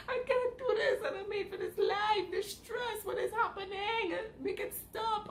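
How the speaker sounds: high, loud pleading through laughter; laughing meltdown. Retold or imitated. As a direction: beg the universe, half laughing, half crying. Retold airport meltdown.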